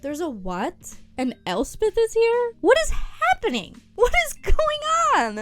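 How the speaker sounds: as if reading an absurd twist aloud; shocked disbelief. Retold or imitated. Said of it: "I was just like".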